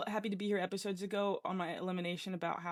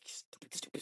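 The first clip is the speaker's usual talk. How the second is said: harsh whisper to herself; 10 dB quieter than usual. Retold or imitated. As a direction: hiss out the self-scolding. Scolding herself in her head.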